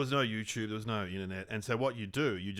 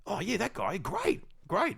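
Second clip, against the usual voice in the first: Netflix exec voice; 9 semitones higher, slower than usual. Retold or imitated. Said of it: "Netflix will probably go".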